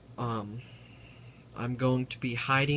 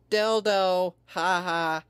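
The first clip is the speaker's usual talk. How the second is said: mocking laugh; 7 semitones higher, 7 dB louder, slower than usual. Real, not acted. Mocking a joke.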